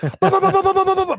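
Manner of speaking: high rapid ba ba ba; frantic pedaling noise. Retold or imitated. Father mimicking his son pedaling furiously, high frantic ba-ba-ba. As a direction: mimic legs pumping fast.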